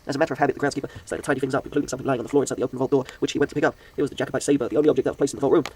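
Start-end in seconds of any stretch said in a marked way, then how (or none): none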